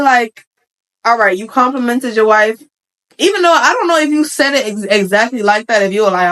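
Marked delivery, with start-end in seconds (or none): none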